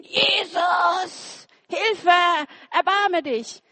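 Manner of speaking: crying out high and loud for help; desperate shout. Acted. A blind beggar shouting to Jesus for help, desperate shout. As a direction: call out desperately to someone passing by. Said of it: "Und Bartimäus fängt an zu schreien".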